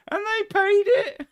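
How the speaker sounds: high, loud cry of amazed delight; incredulous squeal. Real, not acted. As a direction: shoot up high and loud in disbelief. Recalls astonishing payment from viewers.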